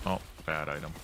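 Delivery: ordinary talk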